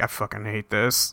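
groaned complaint. Retold or imitated. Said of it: "Ryusei's laying there like".

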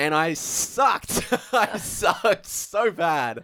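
a high, stretched, laughing confession; laughing self-mockery. Real, not acted. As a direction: drag it out, laughing at yourself. Admitting he was bad at first.

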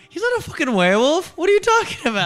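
very high, incredulous mock outburst; hammed-up high-pitched protest; exasperated disbelief. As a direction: squeal the objection as a baffled client. Acted client objecting in disbelief.

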